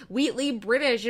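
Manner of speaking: ordinary delivery, nothing marked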